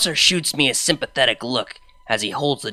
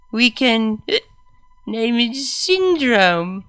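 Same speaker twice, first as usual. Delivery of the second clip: put-on drunk mare voice; 8 semitones higher, slower than usual. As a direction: play her sloppy and giddy drunk.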